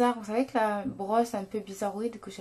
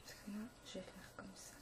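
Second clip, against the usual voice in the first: whispering; 2 semitones lower, 23 dB quieter, faster than usual. Real, not acted. Whispering while concentrating.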